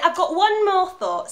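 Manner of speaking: loud, high, slowed-down plea for attention; urgent insistence. Acted. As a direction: hold him back with one last idea.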